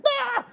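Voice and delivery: sudden high yelp of fright; a frightened priest's high yelp; mock scream. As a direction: shriek like a startled priest. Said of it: "He freaks out".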